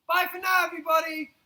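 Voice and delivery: high, loud cheerful goodbye shout; calling goodbye. Real, not acted. Storyteller waving off the children, loud calling. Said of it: "calling goodbye to children".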